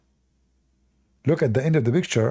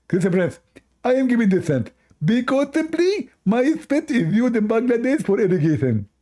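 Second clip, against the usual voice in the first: mock Abdul boast; 9 semitones higher than usual. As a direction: brag absurdly in falsetto. Acted back-and-forth argument.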